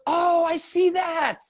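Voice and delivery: high, drawn-out "oh" of discovery; slow, high-pitched mock revelation; acted amazement. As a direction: stretch it out high, imitating an impressed partner. Acts out partner's amazed reaction.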